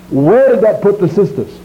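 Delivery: normal talking voice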